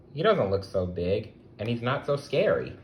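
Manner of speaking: ordinary delivery, nothing marked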